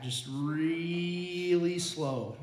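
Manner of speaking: very slow, stretched-out vowel on "really"; drawn-out slowness. Retold or imitated. Storyteller showing the rod gradually bending, drawn out. Miming the rod's slow bend.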